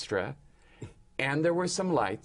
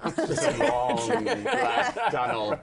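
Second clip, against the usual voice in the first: slow, drawn-out, higher-pitched dread, laughing; mock dread; 8 semitones higher, 4 dB louder, slower than usual. Actor describing the first Tuesday night, in mock dread. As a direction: stretch it out in comic horror.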